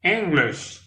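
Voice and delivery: English said /enɡleʃ/, first syllable EN stressed, high and clear; two clear syllables EN-glish; model pronunciation. Shown as a demonstration. Teacher modelling English for French learners. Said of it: "C'est pas un problème".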